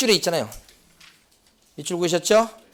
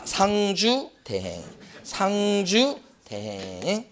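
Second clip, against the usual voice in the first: very slow, stretched syllables; drawn-out memory drill; 3 semitones higher, 5 dB quieter, much slower than usual. Shown as a demonstration. Instructor drilling a mnemonic into exam students, drawn-out. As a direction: stretch the keyword out like a chant.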